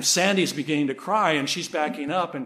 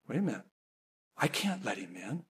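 low, hushed thought to herself; dawning realization; 8 semitones lower, 12 dB quieter, faster than usual. Retold or imitated. Mother realizing she must leave her son outside, hushed realization. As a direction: voice drops as the truth sinks in. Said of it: "and then she realizes".

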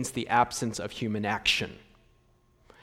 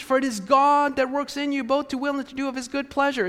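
lightly sing-song, fast and high recitation; congregation's fond quote, lightly mocked; 8 semitones higher, faster than usual. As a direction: recite it like a beloved favourite. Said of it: "don't we love that passage".

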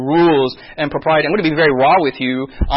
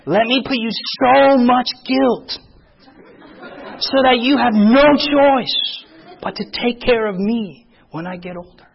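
slow, higher, emphatic parent voice; mock parental guilt trip; 6 semitones higher, slower than usual. Korean parent burdening the child with guilt, mock-solemn and slow. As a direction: lay the guilt on heavily, word by word.

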